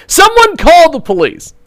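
high-pitched mock yell for help; imitated yell. Host quotes a Molotov thrower caught on tape, mock yelling. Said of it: "is heard on tape yelling".

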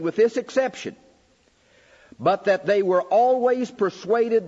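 preaching a quoted text. Acted. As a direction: proclaim old Knox words from the pulpit. Reads Knox quotes like preaching.

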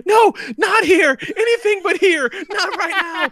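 high, slow mock begging, laughing through it; mock pleading. Imitating the hostage begging at the grave, high mock plea. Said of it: "her tone changed immediately".

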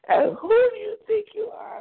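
high-pitched indignant challenge; retold going off. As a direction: act out an outraged mother's comeback. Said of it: "I went off".

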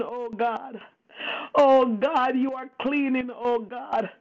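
usual speaking voice

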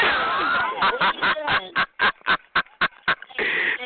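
long cackle breaking into breathy pulses; mocking laugh. Real, not acted. Streamer taunting the rival, long wheezing cackle. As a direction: laugh till only pulses of air are left.

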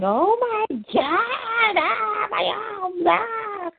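high, slow, wailing cry of pain; mock shriek. Armless shooter shrieking in pain at Clark, high mock wail. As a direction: ham up an agonized shriek.

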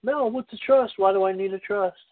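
curious everyman. Pretend. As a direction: play a puzzled member of the public. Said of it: "Let's say I'm, I'm John Q Public".

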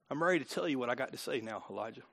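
still small voice. Acted. God speaks in still small voice.

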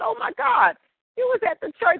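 high, surprised greeting of a friend; pleased surprise at meeting a church friend; excited recognition. Retold or imitated. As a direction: exclaim it like spotting a friend.

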